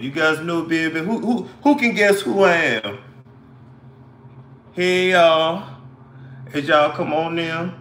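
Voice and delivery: put-on drawn-out New Orleans accent on an even, level pitch. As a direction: greet them in a mock New Orleans drawl. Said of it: "put on my best New Orleans accent".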